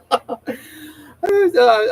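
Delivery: breaking into laughter; giggling through the words; laughing. Real, not acted. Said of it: "I couldn't stop laughing".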